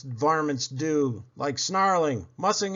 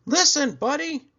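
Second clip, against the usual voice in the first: much higher, firm parental warning; a parent stressing caution; 5 dB louder than usual. Acted. Parents warning Buddy before his hop, firm. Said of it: "his parents warned him".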